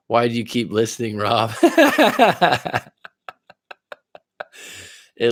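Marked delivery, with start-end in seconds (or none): 1.6-2.8 high, rapid burst of laughter; laughing. Real, not acted. Host teasing Rob for listening in, laughing. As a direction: crack up in a high-pitched giggle.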